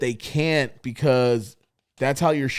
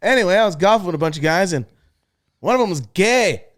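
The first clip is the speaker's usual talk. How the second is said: imitating the comedian; 6 semitones higher, 5 dB louder than usual. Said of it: "he'll be like".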